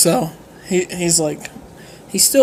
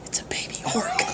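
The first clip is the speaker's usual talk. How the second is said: hushed, scared whisper, higher; 6 semitones higher, 10 dB quieter than usual. Retold or imitated. The man warns her about the orc below in a whisper. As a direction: breathe it like a secret. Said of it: "It's like".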